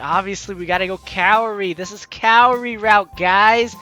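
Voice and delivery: usual speaking voice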